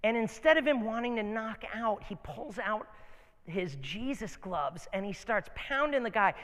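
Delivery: ordinary talk, nothing marked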